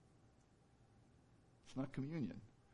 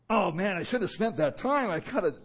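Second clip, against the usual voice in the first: louder, higher fretful cry; frustrated outburst; 9 semitones higher, 10 dB louder than usual. Retold or imitated. Worshipper berating himself after prayer, loud and frustrated. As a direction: scold yourself for losing time. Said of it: "you get out of it and you go".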